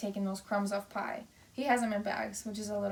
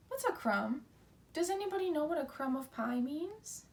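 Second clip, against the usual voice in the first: ordinary talk, nothing marked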